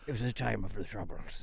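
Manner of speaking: put-on Irish accent. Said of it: "I love the Irish say that".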